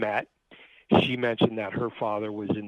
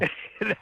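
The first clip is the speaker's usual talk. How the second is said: short high burst of laughter; embarrassed laugh; 11 semitones higher than usual. Real, not acted. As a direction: laugh off the teasing. Laughs at hearing-problem joke.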